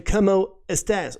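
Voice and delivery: ¿Cómo estás? in a mock gringo accent, cómo as kamoh, estás flat and slow. Teacher mocking how a gringo greets. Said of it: "sound like a gringo".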